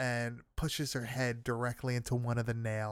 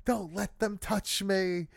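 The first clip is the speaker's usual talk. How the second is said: higher-pitched mock plea to a dog; lightly acted higher-pitched cry for help; imitating frightened command; 6 semitones higher than usual. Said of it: "and she's like".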